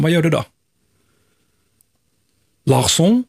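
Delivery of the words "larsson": questioning French accent, higher and rising. Shown as a demonstration. Host suggesting names of Frenchmen, French accent. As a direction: surnames tried out as French, rising. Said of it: "helt fransmän och heter Larsson".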